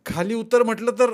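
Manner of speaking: high, loud threatening order; angry shout. A narrator's reading. Furious Rama orders shepherd down, angry shout. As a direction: yell with mounting rage. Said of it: "असे ओरडत तो".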